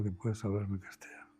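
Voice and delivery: hushed unvoiced coaxing; soft whisper. Therapist coaxing a patient in regression, whispered. Telling patient to speak Spanish.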